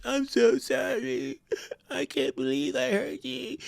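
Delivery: very high, slow, weepy mock sobbing; fake crying apology. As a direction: ham up crocodile tears. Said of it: "using their tears".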